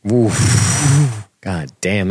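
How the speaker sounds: ordinary talk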